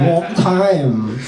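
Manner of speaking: high-pitched, hammed-up Chinese accent; exaggerated Chinese accent, high and stretched out; mock Chinese accent. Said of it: "like, China and be like".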